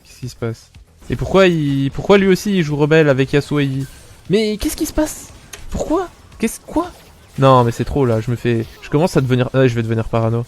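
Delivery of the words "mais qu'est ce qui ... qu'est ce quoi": high, puzzled questioning; bewildered, pitch climbing; baffled disbelief. Real, not acted. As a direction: ask in rising disbelief. Baffled at opponents' builds.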